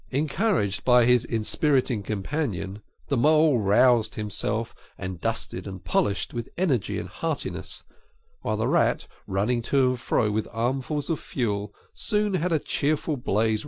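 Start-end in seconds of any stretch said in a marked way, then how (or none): none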